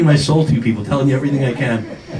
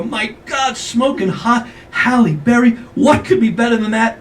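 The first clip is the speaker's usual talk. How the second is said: awestruck raving; 5 semitones higher than usual. Retold or imitated. Comedian gushing over a magazine cover photo, awestruck. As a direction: rave with hammed-up awe.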